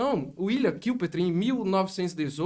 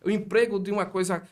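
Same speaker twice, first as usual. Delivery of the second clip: normal talking voice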